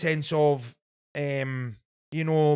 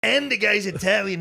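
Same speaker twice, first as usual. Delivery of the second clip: higher boastful Trump voice, laughing through it; Donald Trump; 4 semitones higher than usual. Pretend. Chef asked by host to pitch pizza as Trump, boastful impression. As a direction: brag like Trump selling his pizza. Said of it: "tell me about your pizza in the style of Donald Trump".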